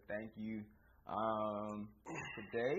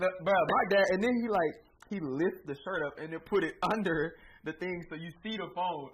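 louder and higher, worked-up storytelling; excited telling; 6 semitones higher, 12 dB louder than usual. Real, not acted. Telling friends about dad's phone holster, louder and higher. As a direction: get fired up describing it.

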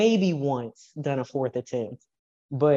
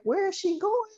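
high, startled cry of alarm; sudden high-pitched alarm. Retold or imitated. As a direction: gasp it out as she tips back. Coach alarmed at lifter leaning.

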